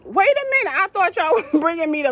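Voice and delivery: high startled wait a minute, half laughing; mock shock at being put on the plane; retold disbelief. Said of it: "So I'm like".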